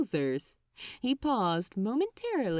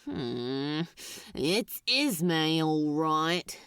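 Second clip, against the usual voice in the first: lower, slow, grudging demon voice; Crowley, groaning; 5 semitones lower, slower than usual. Acted. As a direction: groan out the bad news. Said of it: "confirming with a quiet groan".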